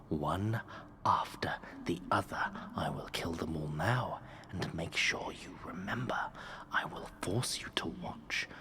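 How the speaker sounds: slow, low hissed threats; a cruel king whispering his promise; menacing king whisper. Acted. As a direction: relish every word of the threat. King threatens to kill friends.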